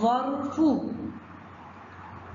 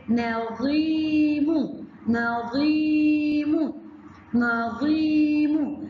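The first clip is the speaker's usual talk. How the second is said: نظيم drawn out slowly, long i held; slow sing-song نظيم; slow stretched word; 3 semitones higher, much slower than usual. Shown as a demonstration. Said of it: "زي كلمة إيه يا ولاد؟".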